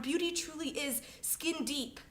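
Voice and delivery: normal talking voice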